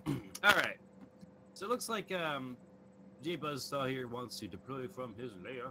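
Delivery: distant voice, like another room; far from the mic. Real, not acted. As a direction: speak from across the room, off mic. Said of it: "It sounds like you're, uh, in another room".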